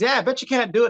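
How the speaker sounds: high-pitched taunting challenge; heckler's dare. Retold or imitated. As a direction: imitate a goading audience member. Heckler dares the comedian.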